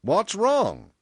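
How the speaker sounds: loud, high question; demanding. A narrator's reading. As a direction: demand to know, taken by surprise. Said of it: "he demanded".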